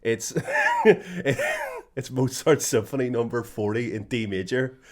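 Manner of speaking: slow, loud, laughing retelling; barely getting it out for laughing; laughing through the punchline. Retold or imitated. Telling a mate the punchline, slow laughing voice. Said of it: "Trigger goes".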